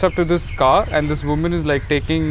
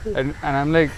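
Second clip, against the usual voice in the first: normal talking voice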